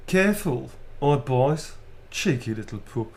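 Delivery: character line in broad Birmingham; cheeky Brummie, breaking into laughter; real Brummie accent. Shown as a demonstration. Asked for an Astarian line, done in Brummie. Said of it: "With my real accent".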